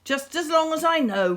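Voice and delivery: a lightly growled grumble. A narrator's reading. The beast grumbles to Meredith, a mild growl. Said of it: "growled the beast".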